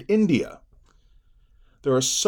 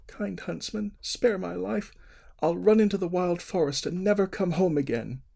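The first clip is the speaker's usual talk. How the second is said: put-on pleading young girl; 9 semitones higher than usual. A narrator's reading. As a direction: beg for your life as a child.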